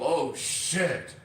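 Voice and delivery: lightly voiced impressed exclamation; mild mock delight; example reaction. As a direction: say it like something real just hit you. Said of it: "and you go".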